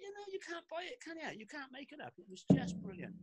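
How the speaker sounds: squeaky with delight after the joke; laughing amazement. Real, not acted.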